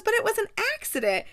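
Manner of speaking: high, fast defensive protest. Acted. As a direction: insist it wasn't her fault. Kate protests to Anthony.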